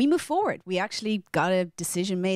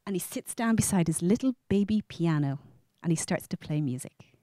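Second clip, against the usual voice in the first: ordinary delivery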